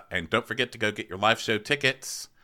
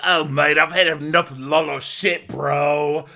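mock Brock rant; 6 semitones higher, 8 dB louder than usual. Imitating Brock's Aussie rant.

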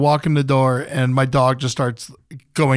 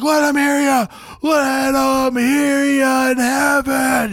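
much higher shout, drawn out slowly at the end; pep rally shout; 10 semitones higher, slower than usual. Retold or imitated. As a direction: yell so it reaches heaven. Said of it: "full pep rally".

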